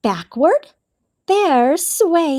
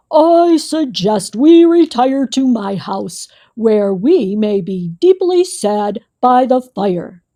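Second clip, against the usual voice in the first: solemn Bear announcement; 2 semitones lower, 3 dB louder than usual. A narrator's reading. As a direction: announce it like a gloomy big bear. Bear's formal gloomy voice.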